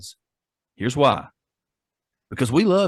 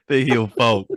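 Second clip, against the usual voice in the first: giggling through the words; cracking up mid-phrase; laughter breaking into the words; laughing; slower than usual. Real, not acted. Laughing while correcting name.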